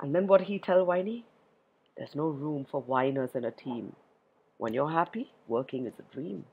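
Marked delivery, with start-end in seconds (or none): 2.0-6.5 quiet admonishment. A narrator's reading. Repeats the moral calmly, softly.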